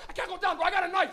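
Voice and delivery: loud, high mock panic; panicked confession. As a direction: blurt it out in mounting panic. Panicked passenger confesses, acted.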